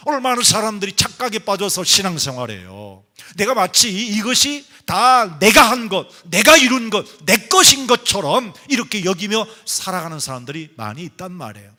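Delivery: loud, high, insistent sermon delivery; impassioned preaching. Real, not acted. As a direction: drive each phrase home to the congregation. Preaches forcefully to the congregation.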